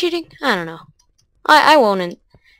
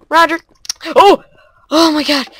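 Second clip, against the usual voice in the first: higher, louder relieved cry; relieved shout; 8 semitones higher, 6 dB louder than usual. Real, not acted. Relief after jumping.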